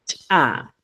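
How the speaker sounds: CHA with an explosive, popped ch; explosive consonant. Shown as a demonstration. Teacher showing first consonant, explosive CHA. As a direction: burst the ch of CHA apart from the vowel. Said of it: "Estos son netamente explosivos".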